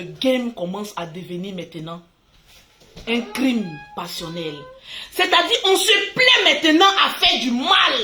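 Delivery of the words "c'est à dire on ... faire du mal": loud, high outburst; shouting. Real, not acted. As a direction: shout it out in anger. Outburst against online hatred.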